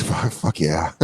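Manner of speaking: low, hushed, horny mutter; Randy Marsh. Retold or imitated. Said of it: "such a seedy, horny voice for food".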